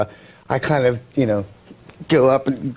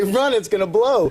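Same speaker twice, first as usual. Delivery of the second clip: panicked warning call, pitched up; shouting a warning; 6 semitones higher than usual. Retold or imitated. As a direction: yell it in a panic, higher. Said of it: "and I panic, and I say".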